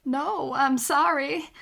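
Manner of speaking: ordinary talk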